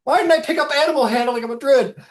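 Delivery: loud, high outraged yelp; shouting druid. Acted. Bitten druid yells in outrage. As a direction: yelp it out as a druid with no animal handling. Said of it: "one of them goes".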